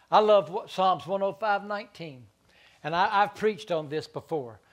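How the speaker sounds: loud, high, sustained pulpit preaching; ringing revival sermon voice; preaching at full force. Acted. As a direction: preach it out to the whole congregation. Preaching on Joseph's story.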